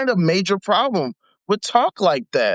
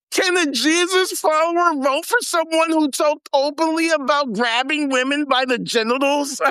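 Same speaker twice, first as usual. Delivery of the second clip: higher, incredulous reading voice; mocking read; 7 semitones higher than usual. Shown as a demonstration. Reading the article aloud to viewers, mocking and higher. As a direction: read it out as if it's absurd. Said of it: "I gotta read this".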